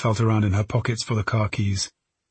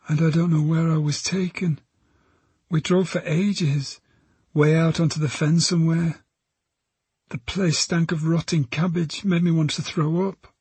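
put-on high girl's voice recounting events; young witness, pitched high and subdued; frightened teenage girl; 7 semitones higher than usual. Girl recounts being driven away, frightened.

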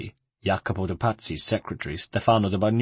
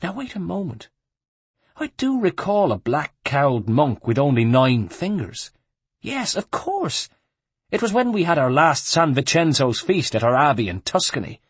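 higher-pitched monk recalling a memory; monk's voice, pitched up as he remembers; rozmyślający mnich; 5 semitones higher than usual. A narrator's reading.

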